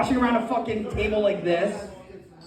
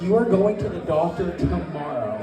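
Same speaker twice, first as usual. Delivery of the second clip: low, stern wife voice; stern order; 4 semitones lower, 4 dB quieter than usual. Acted. Wife ordering husband to the doctor, low and stern. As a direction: lay it down low, no argument.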